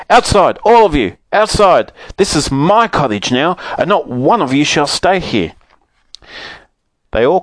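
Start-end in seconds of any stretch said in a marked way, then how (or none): 0.1-5.5 high, loud snowman ordering everyone out; angry shout. Acted. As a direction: bellow them out the door. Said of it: "shouted the snowman angrily".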